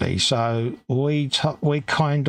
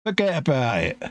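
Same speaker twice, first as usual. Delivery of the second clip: put-on London-sounding stab at New York accent; failed New York accent; 3 semitones higher than usual. Mate attempting New York accent, sounding like London. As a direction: try New York but stay London. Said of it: "That was just London".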